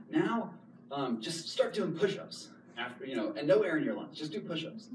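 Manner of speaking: a hint of a teacher giving orders; lightly voiced Wim. Wim telling him to do push-ups, lightly imitated coaching voice. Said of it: "He says".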